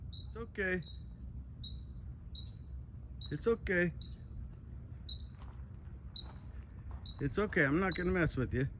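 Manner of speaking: high, soft and gentle; soothing a scared animal. Real, not acted. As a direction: coax it calmly, like calming a pet. Soothing injured bobcat.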